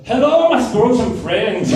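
loud, high, hammed-up Scottish greeting; big comic Scottish accent down the phone; thick Scottish voice. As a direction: imitate a booming Scottish caller. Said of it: "I just hear this thick Scottish voice go".

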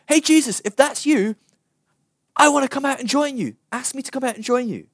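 high, loud shout across water; calling out. Acted. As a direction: hail someone far off, pitched high. Peter calls across water to Jesus.

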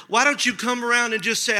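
ordinary delivery

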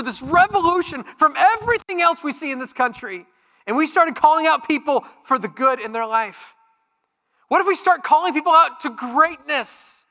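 loud, high, driving sermon voice; full-force preaching. Acted. Pastor to congregation on Jesus calling out goodness, full-force preaching. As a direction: preach it out with rising fire.

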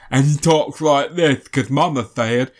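dopey mumbling nice-guy voice; stupid Waterboy voice. Retold or imitated. Imitating Adam Sandler's Waterboy voice for a friend. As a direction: do the simple Waterboy voice. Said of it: "he does a stupid voice".

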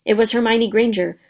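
ordinary delivery

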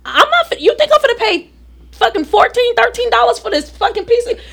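mother mocking a fourteen dollar ticket; laughing rant. Ranting about ticket price.

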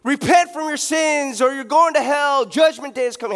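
hammed-up corner preacher yelling repent; bullhorn street preacher. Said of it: "they're on that bullhorn".

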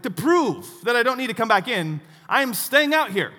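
loud, high, stubborn declaration; retold defiant yell. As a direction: dig in and announce it across the water. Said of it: "And I yelled back".

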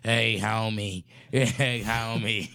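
ordinary talk, nothing marked

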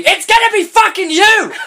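high, loud laughing outburst; incredulous outburst. Real, not acted. Host mocking the character, shrieking outburst. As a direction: shriek it in disbelief.

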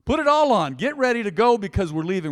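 high, loud, emphatic commands; angel's urgent orders. Acted. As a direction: hurry him out for good. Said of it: "And then he says".

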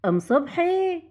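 singsong nagging to get up; calling someone awake. Acted. Waking a deep sleeper.

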